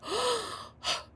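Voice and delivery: long high-pitched mock gasp; stunned gasp. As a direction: gasp as if the crush just confessed. Retelling shock at "Me too.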